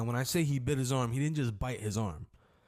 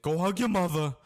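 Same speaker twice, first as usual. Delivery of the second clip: lightly voiced dad, a bit higher and louder; hint of the dad; 6 semitones higher, 4 dB louder than usual. The bleeding dad telling his son, a lightly voiced order. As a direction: give the order like a shaken father. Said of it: "He's like".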